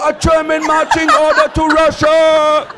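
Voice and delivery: slow, loud, military bark in the German tongue; marching-order shout. Retold or imitated. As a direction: bark it like a drill sergeant. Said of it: "Everybody goes down on the floor".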